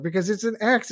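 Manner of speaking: ordinary talk, nothing marked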